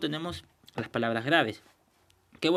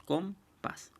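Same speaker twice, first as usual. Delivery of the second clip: compás broken into com, pás; split into syllables; 6 semitones higher, 3 dB quieter than usual. Shown as a demonstration. Teacher dividing a word for the class, compás syllable by syllable. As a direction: say compás as two separate syllables with a pause between. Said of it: "se separa en dos sílabas".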